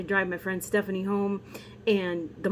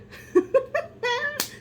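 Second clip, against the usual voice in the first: high burst of laughter. Real, not acted. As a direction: laugh out at your own joke. Laughs at own joke.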